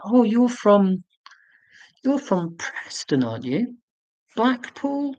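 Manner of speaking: hammed-up clever guesser, leaping up on the last guess; cocky guessing. Streamer re-enacting guessing a man's hometown from his accent, cocky. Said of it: "I was being really clever by guessing".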